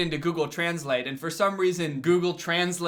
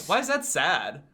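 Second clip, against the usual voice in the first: usual speaking voice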